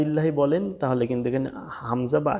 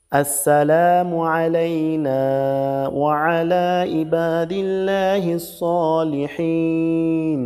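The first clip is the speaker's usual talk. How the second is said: drawn-out Arabic recitation, higher and very slow; 4 semitones higher, 4 dB louder, much slower than usual. Shown as a demonstration. Teacher reciting tashahhud slowly, higher pitched. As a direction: chant it slowly for students to copy.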